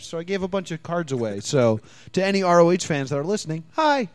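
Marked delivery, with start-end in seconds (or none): none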